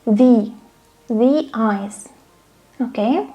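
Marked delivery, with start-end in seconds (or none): 1.1-2.1 THE said "thee" before eyes. Shown as a demonstration. Teacher demonstrating the article before a vowel, THE as "thee". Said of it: "if we have a vowel".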